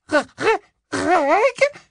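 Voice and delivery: high, loud, faltering disbelief; stunned stammer. Acted. Wife seeing her real husband appear, stunned. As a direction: stumble over his name in shock.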